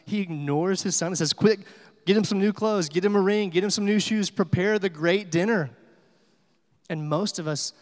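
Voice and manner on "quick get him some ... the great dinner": loud, higher-pitched rush of joyful orders; excited urgent orders. Retold or imitated. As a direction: play the eager father calling out to servants. Excited father ordering servants, retold.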